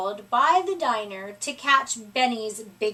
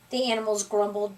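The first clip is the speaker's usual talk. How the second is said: plain reading voice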